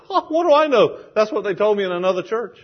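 high-pitched, dismissive retort; acted exasperated comeback; defensive. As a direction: toss it back quickly, disclaiming all knowledge. Acts out woman's defensive retort.

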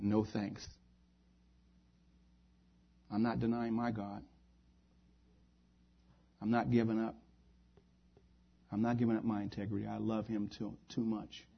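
soft, understated refusal; quiet resolve. Acted. Believers decline to give up their God, quietly resolute. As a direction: refuse quietly, with long pauses between statements. Men's refusal retold softly.

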